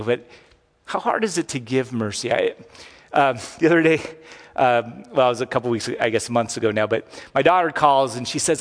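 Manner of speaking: ordinary talk, nothing marked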